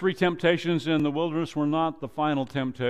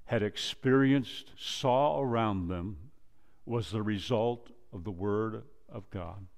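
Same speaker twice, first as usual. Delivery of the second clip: ordinary delivery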